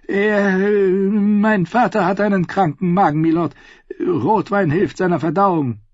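put-on high, hesitant voice of a flustered young lord; nervous stammering son. Son covering for his drunk father, nervous and high.

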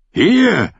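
loud high call. A narrator's reading. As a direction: call across to her. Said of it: "rief er Brienne zu". (laut rufend)

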